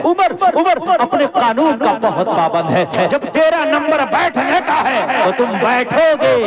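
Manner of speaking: high, loud sermon cry of equality; fiery preaching. Acted. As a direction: proclaim it to the whole crowd at full force. Preaching at full force.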